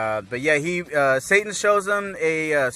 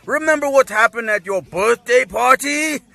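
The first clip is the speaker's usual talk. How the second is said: raised, high-pitched rebuke; acted scolding husband; Vegeta; 9 semitones higher, 6 dB louder than usual. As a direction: throw it at the wife as a loud reminder. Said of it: "here's Vegeta telling his wife".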